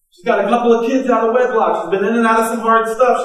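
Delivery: normal talking voice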